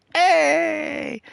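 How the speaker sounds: high sing-song hey; mock Pam hey. Retelling The Office, Pam's high sing-song hey. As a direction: Pam chiming in, belted. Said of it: "she's like".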